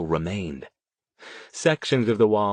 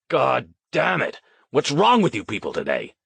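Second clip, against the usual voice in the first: angry outburst; 4 semitones higher than usual. A narrator's reading. Duncan storming in on the crew, angry outburst. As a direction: berate the whole team angrily.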